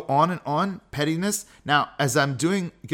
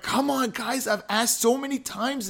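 higher, mock-exasperated nagging; huffy complaint; 10 semitones higher than usual. Dad huffing at family over the dropped backpack, exasperated. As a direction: play up the put-upon parent. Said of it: "and get all huffy".

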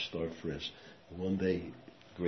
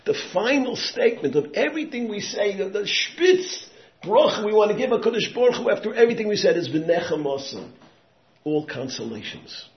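much higher and louder, slowed on key words; fervent preaching; 12 dB louder than usual. Acted. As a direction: lift the crowd higher and higher. Preaching at full force.

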